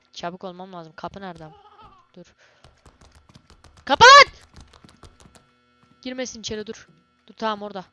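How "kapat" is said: a high, loud cry to shut it; real panicked shout; panicked yell. Real, not acted. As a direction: scream the order in fright. Creature coming, panic.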